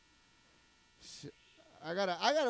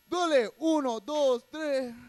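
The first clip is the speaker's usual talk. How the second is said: loud hype count-in, higher; shouted count-in; 5 semitones higher, 8 dB louder than usual. Acted. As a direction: call the count like a hype man.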